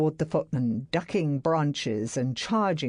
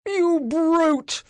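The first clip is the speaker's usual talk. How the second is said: much higher, louder furious bellow; 13 semitones higher, 6 dB louder than usual. A narrator's reading. Enraged master bellowing at Barrett. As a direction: spit out the insult. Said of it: "he bellowed again".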